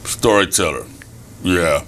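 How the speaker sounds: put-on low, grudging kid's voice; unimpressed older kid. Storyteller mimicking skeptical fifth graders, unimpressed. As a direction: play the too-cool fifth grader, dropped low.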